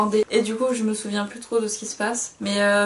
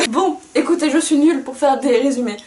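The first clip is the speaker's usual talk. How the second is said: encore en riant; 5 semitones higher, 8 dB louder than usual. Real, not acted.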